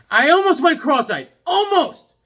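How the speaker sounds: very high, loud gushing; excited exclamation. Real, not acted. Vlogger telling of spotting a rare comic, thrilled yelling. Raving about a comic find.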